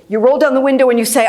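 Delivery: ordinary delivery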